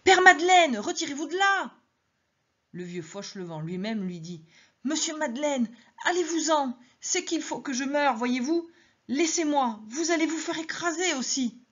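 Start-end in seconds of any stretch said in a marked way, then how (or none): 0.0-1.7 high, loud warning yell; crowd shouting. Acted. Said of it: "On lui cria". 4.9-11.6 put-on high, resigned old man begging; old Fauchelevent begging Madeleine to save himself; pleading old man. Old man begs Madeleine to leave.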